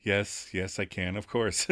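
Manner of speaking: small, low, soft mock-awkward voice; nervous admission. [name] caught out in front of friends, nervously admitting. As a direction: admit it sheepishly under the breath. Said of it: "awkwardly laughs before nervously admitting".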